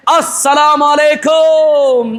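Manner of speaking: stretched-out salaam, syllable by syllable; very slow greeting. Retold or imitated. Preacher mocks the groom's drawn-out salaam to guests. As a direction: drag the greeting out in mock ceremony.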